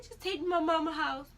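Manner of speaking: high, pleading mock whine; re-enacted weepy request; imitated crying. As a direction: whine it through pretend tears. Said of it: "I burst out and started crying".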